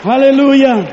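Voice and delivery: high, loud, shouted praise; preaching at full force. Acted. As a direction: rouse the congregation to cheer. Predicación a pleno pulmón. (predicación a gritos, aguda y fuerte)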